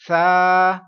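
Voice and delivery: سا said loud with no hiss; teacher shows سا without a whistle. Shown as a demonstration. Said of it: "پڑھیں گے اس حرف کو؟".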